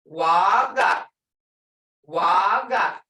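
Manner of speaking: "Waga" loud, with a long first "ah"; stressed tones. Shown as a demonstration. Teacher asking students to hear tones, marked tone. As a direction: hold and punch the first syllable of "Waga". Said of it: "Hear that tone?".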